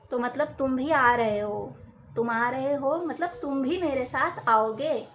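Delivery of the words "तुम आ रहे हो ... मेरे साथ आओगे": ordinary talk, nothing marked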